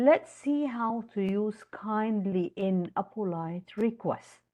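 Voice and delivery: ordinary delivery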